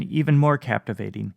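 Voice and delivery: ordinary delivery, nothing marked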